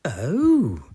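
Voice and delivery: loud surprised granny. Acted. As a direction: gasp it out loud. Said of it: "Granny Pig said".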